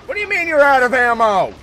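high, loud, fast outburst; exasperated, rapid shouted question; incredulous yell. Pretend. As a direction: shout in disbelief at the troops. Gamer berates soldier out of ammo.

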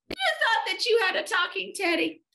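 very high, gleeful teasing; yelping. A narrator's reading. Eddie mocks the bear's mistake, yelping. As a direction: crow it high like a teasing child. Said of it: "yelped Eddie".

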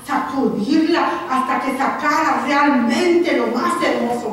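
loud, rising sermon voice; preaching at full force. Acted. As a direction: drive the point home to the congregation. Preaching builds. (predicando con fuerza)

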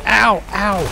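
high, loud repeated "ow"; yelps of pain. Real, not acted. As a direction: yelp at each fresh hit. Hit repeatedly in game.

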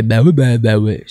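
mock attempt at an Antillean accent, loud at first; bad Antillean accent. Comic retelling a man's poor imitation to the host, mock Antillean accent. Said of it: "un super accent antillais".

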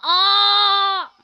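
loud, high mock scream; imitated scream. Retelling how a friend screamed, mock scream. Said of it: "and then Montez screamed".

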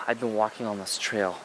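ordinary delivery

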